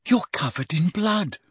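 put-on high, concerned girl's voice; dismayed Hermione. Hermione sees Harry's bloodied face, dismayed. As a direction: point it out in high-pitched dismay.

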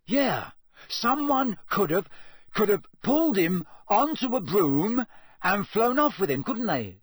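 normal talking voice

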